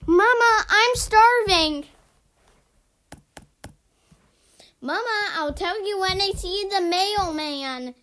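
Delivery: loud call to mama. Real, not acted. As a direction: yell it across the house. Calling out to mother.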